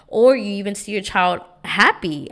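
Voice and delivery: ordinary talk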